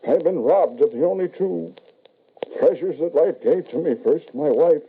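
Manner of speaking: grief spilling over into self-pity; bitter lament. Acted. Widowed father mourns his wife and son to the medium, high and aching.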